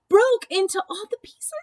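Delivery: storyteller's big dramatic moment; dramatic burst. A narrator's reading. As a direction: throw the line out like the chair breaking. Dramatic climax of narration.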